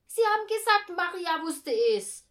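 put-on high, loud child's objection; indignant challenge. A narrator's reading. Boy contradicts mother about Maria, indignant and loud. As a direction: protest loudly, pitched well up.

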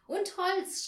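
high, loud interjection; excited shout. A narrator's reading. Anja shouts her addition to the plan, excited shout. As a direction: shout it out eagerly. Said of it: "schrie Anja".

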